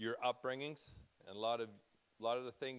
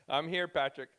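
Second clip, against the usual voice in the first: loud, raised call to a viewer; 5 semitones higher, 10 dB louder than usual. Real, not acted. Speaker answers viewer Patrick watching online, calling out. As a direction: call it out to be heard.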